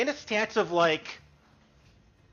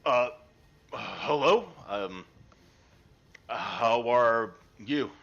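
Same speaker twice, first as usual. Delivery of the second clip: lower, unhurried humble fisherman; gruff-free friendly old fisherman, lower voice; fisherman Dale; 8 semitones lower than usual. Acted. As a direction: speak as a polite, simple fisherman.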